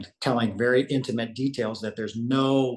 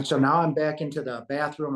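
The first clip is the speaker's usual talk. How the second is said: ordinary talk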